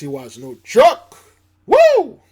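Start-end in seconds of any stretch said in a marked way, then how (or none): 1.6-2.2 high, loud whoop; excited whoop. Real, not acted. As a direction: let out a loud woo. Host cheering after hurricane season mention.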